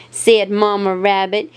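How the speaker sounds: plain reading voice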